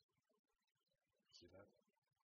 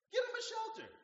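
urgent order; 13 dB louder than usual. Retold or imitated. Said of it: "his dad said".

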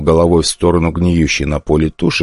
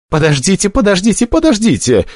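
higher, rising more each time, panicked; gabbling plea; 13 semitones higher than usual. Acted. The wounded summoner begs Stronger to wait, building panic. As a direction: pile up the pleas faster and higher. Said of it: "зачастил Потлатый".

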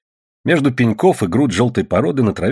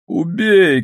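high desperate cry; beaten man's cry; 10 semitones higher than usual. A narrator's reading. Beaten man to the crowd, high desperate cry. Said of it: "кричал избитый".

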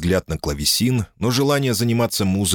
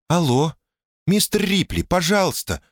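much higher, tense phone voice; high thin woman's voice; 11 semitones higher than usual. A narrator's reading. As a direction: voice her high and strained. Janice calls Tom on phone.

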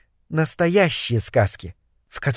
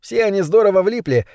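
plain reading voice

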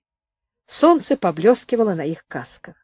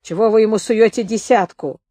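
plain reading voice

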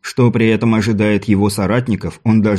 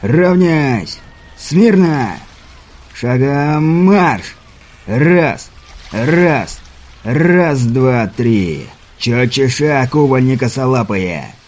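shouting drill sergeant; 3 semitones higher, much slower than usual. Acted. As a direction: bark orders at recruits on the parade ground. Drill commands on the parade ground.

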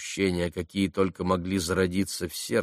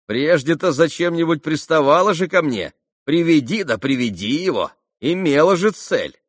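высоко и громко, с поддёвкой; припомни ей прежние уговоры; насмешливый Ракитин; 7 semitones higher, 7 dB louder than usual. Acted. (язвительно, громко попрекает)

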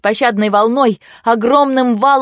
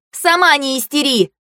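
high, indignant comeback; heated squabbling retort; snappish retort; 6 semitones higher than usual. A narrator's reading. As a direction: throw the accusation back, voice raised in pitch.

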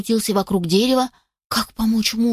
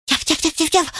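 high, quick puppy yaps; puppy barking; 7 semitones higher than usual. A narrator's reading. Puppy barks at uncle's kennel, yapping urgently. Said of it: "громко залаял".

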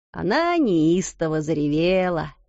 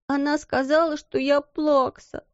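put-on sniffling child; 7 semitones higher than usual. A narrator's reading. Little girl complaining to her friend, sniffling. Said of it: "всхлипывала Лотти".

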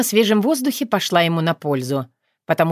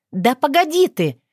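sharp, higher angry outburst; snapping at him to wait; 7 semitones higher than usual. A narrator's reading. Marinka cuts Taras off angrily. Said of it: "сердито замахала руками".

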